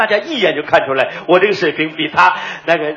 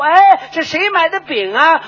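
high, slow call across a shop; waiter calling out; 9 semitones higher, slower than usual. Retold or imitated. Waiter calling out in the breakfast shop. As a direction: call out to the customers. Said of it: "在那喊".

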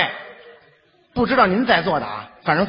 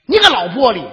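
louder hammed-up insult; mock insult; 4 semitones higher, 6 dB louder than usual. Young man insulting the woman on the bus, mock angry. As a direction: hurl the insult.

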